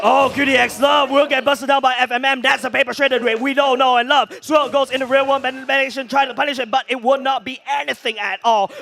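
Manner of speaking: loud, high, fast-paced shoutcast call; excited play-by-play. Real, not acted. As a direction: call the teamfight at full hype.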